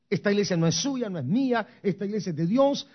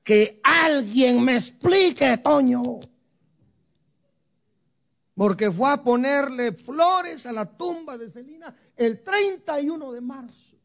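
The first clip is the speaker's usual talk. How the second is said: mock exasperated appeal; 6 semitones higher, 5 dB louder than usual. Preacher appealing to Toño, mock exasperated plea. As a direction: throw up your hands at the absurdity.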